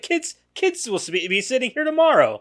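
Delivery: lightly imitated character, pitched high; high-pitched retelling of his line; mock movie character. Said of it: "He's like".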